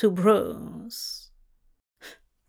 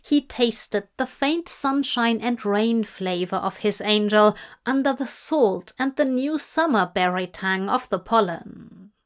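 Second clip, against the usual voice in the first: ordinary delivery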